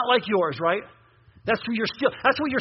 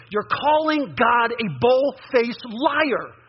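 slow emphatic; much slower than usual. Acted. Preacher stresses accusation slowly.

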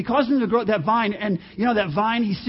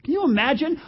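normal talking voice

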